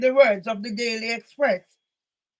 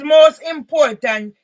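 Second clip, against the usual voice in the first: slow shouted emphasis on most important; emphatic; 2 semitones higher, 8 dB louder, slower than usual. Real, not acted. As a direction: hammer home the key point. Urging viewers to use hashtag.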